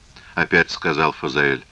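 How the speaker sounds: plain reading voice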